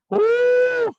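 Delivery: very high, loud whoop; excited woo. Real, not acted. Instructor whoops to fire up the punching class.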